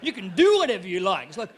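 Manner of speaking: ordinary talk, nothing marked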